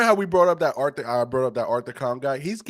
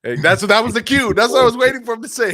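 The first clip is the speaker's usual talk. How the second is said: excited; 8 semitones higher, 8 dB louder, faster than usual. Real, not acted. Excited at hearing the cue.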